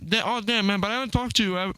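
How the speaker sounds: talking through a mouthful; mouth full. Real, not acted. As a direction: speak around a bite of food. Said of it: "Are you fucking talking with food in your mouth".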